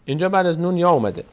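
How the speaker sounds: ordinary talk, nothing marked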